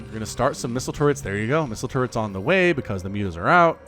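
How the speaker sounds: ordinary talk, nothing marked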